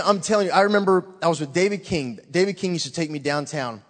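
ordinary talk

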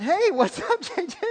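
high, amused hello through a laugh; laughing greeting. Retold or imitated. Preacher retells greeting a friend in curlers, laughing. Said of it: "I'm kinda laughing".

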